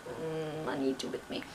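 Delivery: very low, soft insistence; low plea. Retold or imitated. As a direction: dig in quietly with a low, reluctant hum. Retold plea to mum.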